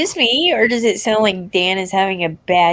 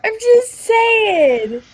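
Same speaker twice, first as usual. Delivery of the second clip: drawn-out teasing; 12 semitones higher, much slower than usual. Real, not acted. Defensive drawn-out quip.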